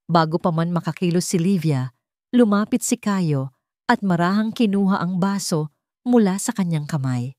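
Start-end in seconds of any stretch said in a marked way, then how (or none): none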